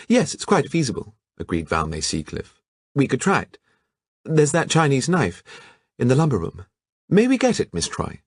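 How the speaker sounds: high, bright affected voice; affected society girl. Acted. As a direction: gush like a vain beauty. Seacliff eager, affected.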